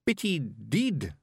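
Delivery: lightly voiced, slow Scottish Feegle. A narrator's reading.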